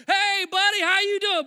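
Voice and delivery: loud, high call across a room; mock effusive pastor greeting; hearty hailing. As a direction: hail someone like your favorite person. Pastor hails another man, retold.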